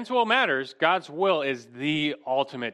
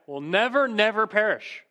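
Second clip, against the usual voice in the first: "never, never" pitched high, each one punched; emphatic double never; 7 semitones higher, 3 dB louder than usual. Retold or imitated. Preacher stressing Jesus' promise to his sheep, emphatic. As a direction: stress both words of "never, never" with a raised voice. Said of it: "saying emphatically in the strongest way possible".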